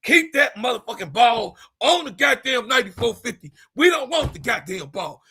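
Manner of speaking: hammed-up angry vendor. Concession stand worker yells at Caitlin in mock anger. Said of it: "Concession stand said".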